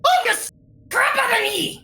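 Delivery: high-pitched startled shriek; frightened shriek. Acted. Little merchant yelling at the one who scared him, shriek.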